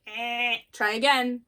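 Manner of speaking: loud buzzer noise, then the words; mock game-show buzzer, loud. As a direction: buzz them like a quiz show wrong answer. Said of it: "The annoying game show buzzer".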